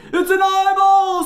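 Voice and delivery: very high, loud shriek of horror; screaming. A narrator's reading. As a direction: scream the words in panic. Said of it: "screamed Raj".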